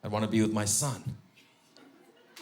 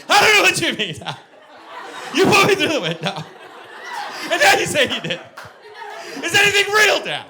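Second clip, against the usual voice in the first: put-on much higher, loud, frantic boy; son panicking; 8 dB louder than usual. Son confused by dad's denial, high loud panic. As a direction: spiral into panic like a little boy. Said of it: "He would have been like".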